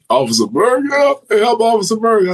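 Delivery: imitated plea leaping high in pitch; mock screaming for help. Injured inmate calling the officer for help, mock high-pitched cry. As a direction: mimic a hurt man calling out, rising to a high wail. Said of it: "of course he started screaming".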